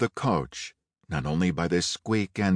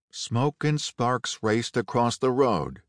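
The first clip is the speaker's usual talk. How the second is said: usual speaking voice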